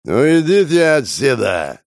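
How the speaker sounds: put-on slow, slurred drunken shout. A narrator's reading. Prokhor fakes drunkenness to send the police away. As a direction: put on a drunk to fool them. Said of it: "пьяным голосом".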